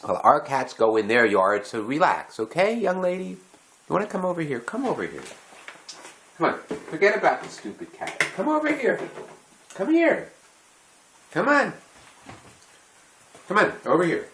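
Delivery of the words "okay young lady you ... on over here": much higher sing-song coaxing; coaxing dog talk. Real, not acted. Owner calling the dog over, high and coaxing.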